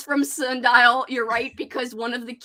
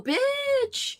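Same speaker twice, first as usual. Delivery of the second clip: high mock bitch greeting; 12 semitones higher than usual. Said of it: "I'll go".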